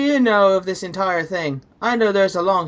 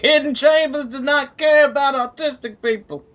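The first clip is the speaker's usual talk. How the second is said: lightly voiced celebrity rant, a little higher; 6 semitones higher than usual. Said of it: "imagining a video of [name] saying".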